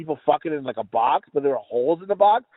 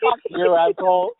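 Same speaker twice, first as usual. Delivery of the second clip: laughing insult. Real, not acted.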